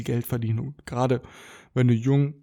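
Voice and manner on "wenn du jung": holding back a burp. Real, not acted. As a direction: voice tight from a coming burp. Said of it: "ich muss aufstoßen". (gegen ein Aufstoßen ansprechen)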